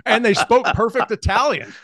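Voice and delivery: loud, high and slow through laughter; laughing punchline. Real, not acted. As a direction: crack up while delivering the punchline. Laughing at his own punchline.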